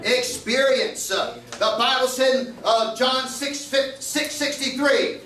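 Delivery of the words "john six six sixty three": normal talking voice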